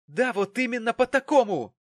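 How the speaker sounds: loud, high-pitched outburst; shrieked retort; angry shouting. A narrator's reading. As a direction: yell it back at your father. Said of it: "закричала Черри".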